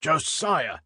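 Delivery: high-pitched urgent call; crying out. A narrator's reading. Handcuffed inspector spots his constable, crying out. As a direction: call a colleague for help. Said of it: "cried the inspector".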